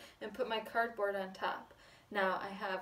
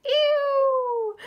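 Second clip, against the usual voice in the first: very high, loud drawn-out squeal; screaming hog; 15 dB louder than usual. Acted. Hog sprayed by the flea's sneeze, screaming. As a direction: scream a long disgusted ew. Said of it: "who screamed".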